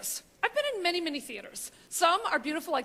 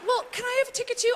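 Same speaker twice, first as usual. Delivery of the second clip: higher, quick pleading ask; hopeful begging; 8 semitones higher, faster than usual. Retold or imitated. Steve begging the narrator, a quick pleading ask. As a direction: slip the ask in fast. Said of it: "And then he goes".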